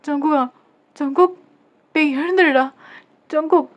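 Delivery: acted panicky wake-up call; urgent calling out. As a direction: try to wake him, loud and high. Calling him to wake from a faint.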